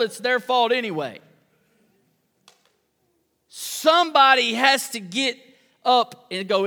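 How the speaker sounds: loud, high, pressing sermon delivery; impassioned altar-call preaching; fervent preaching. Acted. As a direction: drive the point home from the pulpit. Preacher building to fervent climax.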